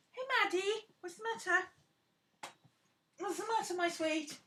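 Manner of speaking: high, sweet coo to the dog; high doting coo to her dog Maddie. Real, not acted.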